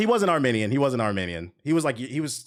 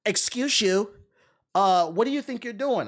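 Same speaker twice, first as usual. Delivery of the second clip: light mock European accent, higher; 7 semitones higher, slower than usual. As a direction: put on the entitled neighbor's European accent, half-hearted. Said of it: "This dude had, like, an accent".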